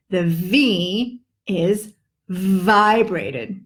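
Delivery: very slow, buzzing each V. Shown as a demonstration. Teacher demonstrates the vibrated V slowly. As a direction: let the V in vibrated buzz long. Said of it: "Do you see what I did there".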